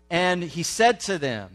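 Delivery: ordinary delivery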